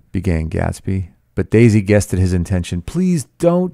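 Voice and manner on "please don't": high-pitched helpless plea; helpless pleading. A narrator's reading. Daisy interrupts Gatsby before he tells, helpless. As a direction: cut in, begging him to stop. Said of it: "she interrupted helplessly".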